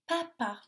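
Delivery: PAPA as pa, pause, pa; split into syllables. Shown as a demonstration. Teacher gives children a word, PAPA split in two syllables. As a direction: break PAPA into two separate beats. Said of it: "dans les deux syllabes".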